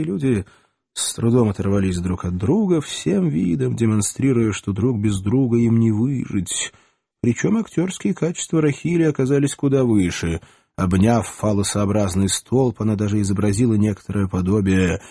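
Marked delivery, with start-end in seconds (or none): none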